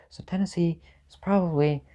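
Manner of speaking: normal talking voice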